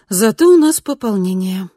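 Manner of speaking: forced bright voice; fake cheerful. A narrator's reading. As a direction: put on a cheerful front. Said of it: "делано бодро сказала она".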